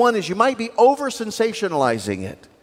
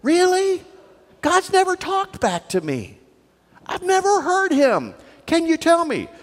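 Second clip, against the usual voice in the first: higher, mock wide-eyed wonder; incredulous friend; 6 semitones higher than usual. Seminary friend teasing pious students, higher and wide-eyed. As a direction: ask like an innocent doubter. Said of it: "and he said".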